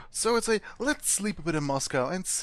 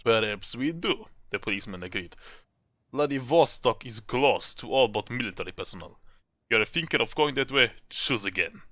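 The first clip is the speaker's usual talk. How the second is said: put-on stern Russian police accent, pitched lower; 4 semitones lower than usual. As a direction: lean into a thick Russian officer's accent. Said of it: "Russian accent".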